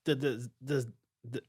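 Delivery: halting, repeated false starts; real stuttering. Real, not acted. Fan tripping over why they love the suit, stuttering. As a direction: stumble on the word, stuck. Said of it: "I'm stuttering".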